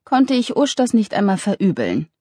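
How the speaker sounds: usual speaking voice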